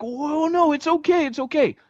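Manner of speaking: very high, panicky soothing, slowing at the end; flustered comforting. Retold or imitated. Russell trying to comfort the crying girl, flustered high voice. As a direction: tough guy fumbling to calm a crying girl. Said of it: "didn't know what to do with those emotions".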